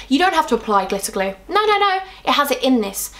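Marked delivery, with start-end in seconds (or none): 1.5-2.0 high, playful no no no; emphatic no. Real, not acted. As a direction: wave it off playfully. Rejecting glitter glue.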